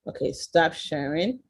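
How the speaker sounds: usual speaking voice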